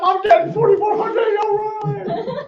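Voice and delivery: high, shouted imitation of a thrilled client, breaking into a laugh; pumped up. Retelling a client's outburst over her refund, excited shout. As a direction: yell it like someone who just scored big money. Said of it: "she gets all pumped up".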